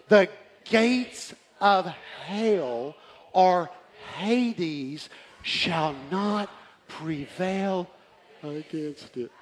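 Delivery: very slow, drawn-out pulpit reading; weighty scripture reading. Real, not acted. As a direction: stretch each word for solemn emphasis. Reads scripture with dramatic weight.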